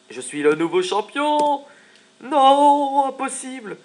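high, loud, slow boast turning into a shrieking cry; rival champion. Acted. Rival who loses his title to the player, hammed-up shrieking disbelief. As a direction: play the beaten rival, hammed-up and outraged.

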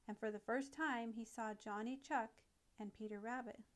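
ordinary delivery

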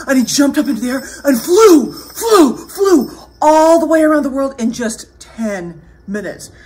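loud, high, swooping telling; excited storyteller narrating a flight; dramatic story narration. A narrator's reading. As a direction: launch each "flew" with a big lift.

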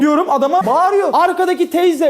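ordinary delivery

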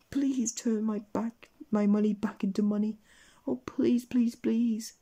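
high-pitched, drawn-out pleading; begging. A narrator's reading. Mister Mean begs the wizard to restore his money, pleading. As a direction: wail and plead in hammed-up desperation. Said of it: "he begged".

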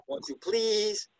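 high, stretched-out "Won't you please"; high, slow bird section. Shown as a demonstration. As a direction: draw it out high like the song. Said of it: "then there's the".

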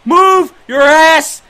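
slow, high, very loud yell; drawn-out shout. Real, not acted. Gamer urging his runner on, slow drawn-out shout. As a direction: stretch each word as you holler.

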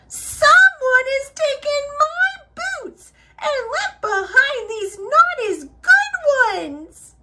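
high, squeaky wail; Baby Bear. A narrator's reading. Baby Bear whose boots have disappeared, wailing. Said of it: "she wailed".

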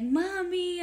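high-pitched mock whine; retold crying plea. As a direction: imitate a tearful child calling mom. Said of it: "like, crying".

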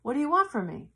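loud, exasperated God; God fed up, loudly answering back. Retold or imitated. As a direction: throw up your hands as God. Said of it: "He says".

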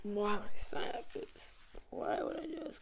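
breathy dread whisper to himself; hushed, regretful whisper. Real, not acted. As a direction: whisper it like you regret agreeing. Dreading the clip he agreed to.

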